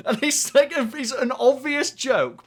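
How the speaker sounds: high, slow words through laughter; laughing while talking. Real, not acted. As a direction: talk while still laughing.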